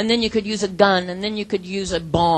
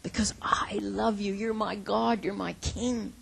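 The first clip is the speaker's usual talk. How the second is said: softer, almost whispered devotion; humble prayer; 7 dB quieter than usual. Acted. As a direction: pray it gently on your knees. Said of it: "We should be on our knees saying".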